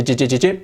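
"j" repeated as a crisp English dj; drilling the j sound. Shown as a demonstration. Teacher drilling the sound for students. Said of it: "Ese sonido, j".

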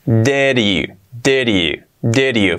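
"Did you" joined as "deriu"; linked, soft R. Shown as a demonstration. Teacher linking words in blocks, soft-r "Did you". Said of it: "se convierte en una R suave".

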